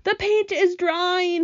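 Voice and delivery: slow, high drawn-out moan. A narrator's reading. Centipede stiffening under the paint, moaning. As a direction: moan it miserably, stretching the words. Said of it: "he moaned".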